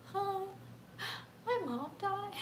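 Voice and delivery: high, tearful and breaking; choked-up sob. Retold or imitated. To the flight attendant, reliving it with a tearful, breaking voice. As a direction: let the voice crack on the news.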